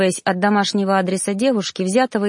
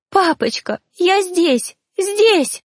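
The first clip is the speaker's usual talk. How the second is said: much higher, rising desperate crying call; anguished cry; 9 semitones higher, much slower than usual. A narrator's reading. Ghost girl calling to her father, anguished and high. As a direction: beg him to notice her. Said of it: "мучительный крик".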